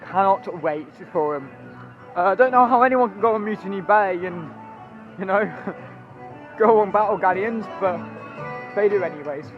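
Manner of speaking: normal talking voice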